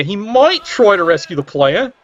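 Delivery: "might" leaned on with a pitch jump; "might" punched out from the rest of the sentence; stress on "might". Real, not acted. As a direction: hit the word "might" hard, as a real doubt. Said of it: "I do stress the word might".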